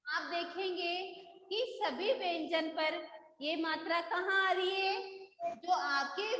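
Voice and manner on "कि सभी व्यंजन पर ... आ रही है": usual speaking voice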